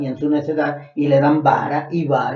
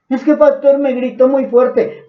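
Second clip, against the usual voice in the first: whiny complaint; 7 semitones higher, 5 dB louder than usual. Retold or imitated. Member whining about being scolded.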